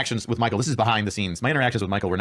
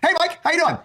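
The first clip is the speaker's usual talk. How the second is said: much higher, bright friendly greeting; exaggerated cheery hello, pitched way up; friendliest tone; 3 dB louder than usual. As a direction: greet him like an old pal, overly sweet. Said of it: "Just most friendliest, non-confrontational tone".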